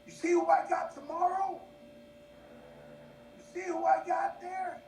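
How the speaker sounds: high-pitched, excited bragging; boastful insistence. Retold or imitated. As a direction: push them to be impressed. Pressing family to be impressed.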